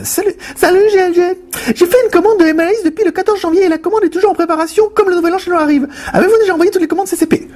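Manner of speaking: piercing sustained falsetto; high female impression. Host reading Karine's order question, high falsetto. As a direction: squeak it all out on one high note. Reading a woman's comment aloud.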